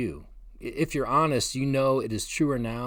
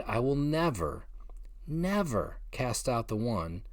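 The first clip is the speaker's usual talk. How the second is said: second "never" slowed and stressed; emphatic never; 3 dB quieter than usual. Retold or imitated. Preacher quoting Jesus's promise, emphatic on "never". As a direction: lean hard on each "never". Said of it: "Jesus emphatically said".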